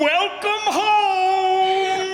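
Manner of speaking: high, very slow, proud announcement; grand welcome. Acted. Father to daughter at the run-down farm, a grand slow welcome. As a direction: unveil it like a grand prize. Father shows the new house.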